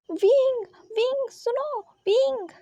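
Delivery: high, urgent name-calling. Acted. Sijoi trying to call Wing over the noise, high urgent calls. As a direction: call over a noisy crowd to be heard. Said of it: "wing को बुलाने की कोशिश".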